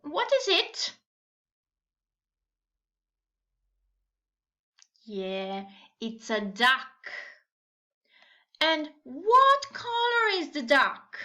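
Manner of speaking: put-on reading to a toddler. Teacher questioning small children.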